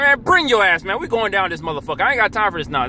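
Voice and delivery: no patience, order him down the escalator; stern father. Retold or imitated. What he would tell his child.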